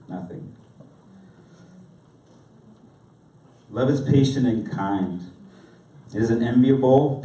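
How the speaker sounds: measured, expressive reading with soft falling phrase ends; solemn recitation with deliberate pauses; scripture reading. Real, not acted. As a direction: read it reverently, letting key phrases drop quiet. I'm gonna read this.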